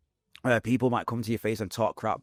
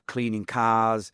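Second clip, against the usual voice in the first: usual speaking voice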